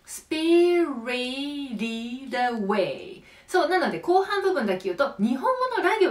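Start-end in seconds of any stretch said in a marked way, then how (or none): 0.0-3.2 "スピリティッドアウェイ" slowly, all one linked word. Shown as a demonstration. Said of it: "もう一回いくよ".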